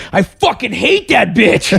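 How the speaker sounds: high, slow yell of contempt; hammed-up angry outburst; mock outrage. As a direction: imitate a friend ranting down the phone. Retells friend's hostile phone reply.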